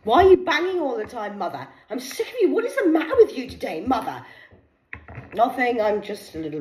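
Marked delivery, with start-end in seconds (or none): 0.0-4.2 high, nagging daughter voice, laughing; mock daughter. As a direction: whine at mum like a teenager. Mocking daughter nagging mum.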